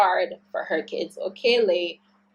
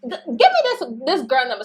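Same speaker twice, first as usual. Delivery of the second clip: imitating an angry mother ready to fight; angry mom; 8 semitones higher than usual. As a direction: play the fired-up mom taking over. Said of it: "she is getting angry for me".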